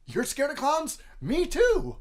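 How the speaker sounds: very high-pitched, hammed-up clown panic; mock-scared clown reply. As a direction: blurt it in exaggerated shared alarm. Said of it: "our first response is".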